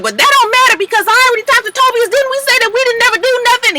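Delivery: high, fast, put-on girl voice, pushing back; mock indignant Carly. Carly defending herself to Keely, high and fast mock protest. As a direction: rattle it off high and quick like a defensive friend.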